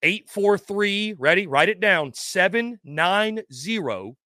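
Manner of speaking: very slow, patronizing dictation, pitched high. Real, not acted. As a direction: stretch every digit as if for a slow learner. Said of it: "say it slower for you, Chuck, I will".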